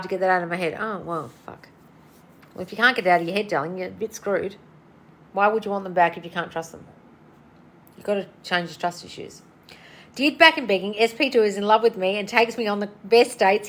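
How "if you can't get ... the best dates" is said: normal talking voice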